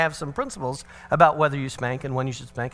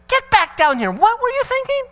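much higher and louder, furious call down the stairs; angry yell; 8 dB louder than usual. Retold or imitated. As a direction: shout him back down in exasperation. Father yells son back down.

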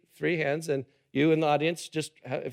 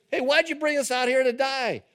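higher-pitched mock whine; whining complaint; 6 semitones higher than usual. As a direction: whine it like an ungrateful kid. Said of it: "kind of a complaining, whining voice".

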